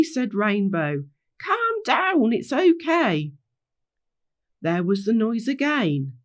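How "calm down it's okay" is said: high, insistent calming call; calming the group. Acted. Rainbow telling the frightened gang it's okay, high calming call. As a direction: settle them with drawn-out words.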